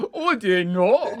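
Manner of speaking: slow, high, hammed-up German accent; mock German accent. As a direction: draw it out as a cartoon German. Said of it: "how we feel that all German people, uh, sound like".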